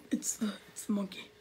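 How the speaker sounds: hushed whisper; scared whisper. Real, not acted. Gamer whispering as he spots the monkey. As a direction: whisper it so it won't hear.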